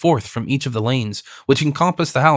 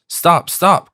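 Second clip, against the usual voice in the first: higher, raised halt; crying out; 5 semitones higher, 4 dB louder than usual. A narrator's reading. Leader halts his horsemen on hearing a strange voice, crying out. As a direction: call a halt, mildly urgent. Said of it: "cried he whom they had addressed".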